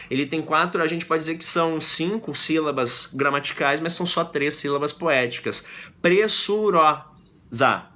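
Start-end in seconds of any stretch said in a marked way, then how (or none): 6.0-7.9 very slow, drawn-out "Pressurosa", syllable by syllable; scanning syllables. Shown as a demonstration. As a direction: stretch "Pressurosa" to count its beats. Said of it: "Assim que a gente conta".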